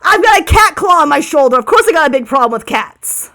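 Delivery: loud, high indignant retort; dude-voiced bird. Clawed bird answering about its problem with cats, outraged and loud. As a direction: snap back as an outraged bird. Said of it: "I have to do a dude voice".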